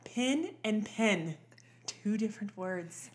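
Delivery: over-enunciated vowels, "I" and "E" held apart; slow, deliberate diction lesson; proper mother. Retold or imitated. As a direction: spell it out like an insistent mom. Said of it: "I'll say it again".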